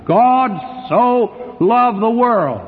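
each word punched high and slow, "God... so... loved... the world"; ringing, stretched stresses on "God", "so" and "loved"; emphatic quoting. Acted.

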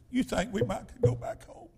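hushed, near-whispered question; timid plea. Retold or imitated. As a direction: imitate a discouraged wife asking softly. Discouraged wife asks to go home.

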